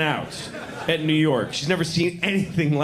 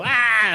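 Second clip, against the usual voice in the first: sharp high yelp; struck cry; 6 semitones higher, 4 dB louder than usual. Pretend. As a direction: cry out as if hit by lightning. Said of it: "and I go".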